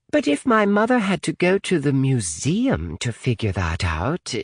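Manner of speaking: high-pitched girl, voice clearly put on; Natalie's character voice. Natalie questioning her mother's plan, high-pitched.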